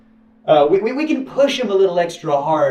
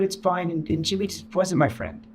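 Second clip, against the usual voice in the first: normal talking voice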